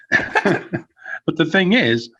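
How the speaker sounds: slow words coming out through laughter; laughing restart. Real, not acted. Host returning to his point on Doudrop, laughing. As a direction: try to resume through a laugh.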